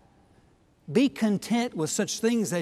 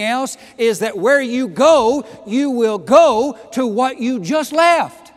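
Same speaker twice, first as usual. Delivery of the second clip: louder, higher, slow and forceful preaching; preaching at full force; 5 semitones higher, 9 dB louder than usual. Acted. Pastor urging his congregation to stay focused, full-force preaching. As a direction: build to a ringing exhortation.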